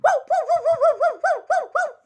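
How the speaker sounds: very high, loud yappy woo woo; excited high puppy yips; puppy barking. A narrator's reading. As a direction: bark like a happy little puppy.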